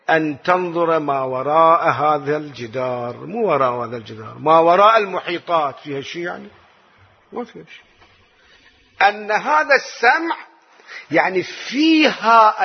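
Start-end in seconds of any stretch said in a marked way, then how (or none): 5.8-7.8 very soft question and answer; hushed rhetorical aside. Acted. Preacher quietly assuring the crowd, very soft. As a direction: drop almost to a whisper.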